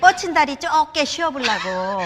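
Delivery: loud, hammed-up Jeolla dialect psalm. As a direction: recite it in thick Jeolla dialect, laughing through it. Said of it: "전라도 버전". (전라도 사투리로 크게 웃으며 시편 낭송)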